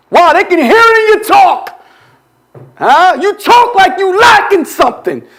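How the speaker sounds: high, driving sermon cadence; full-force preaching. Real, not acted. Preacher scolding congregation for talking from lack, forceful preaching. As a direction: hammer each charge at the congregation.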